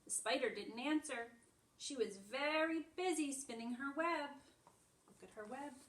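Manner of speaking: animated picture-book read-aloud; reading to children. Acted. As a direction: read it brightly to little ones. Reads picture book to class.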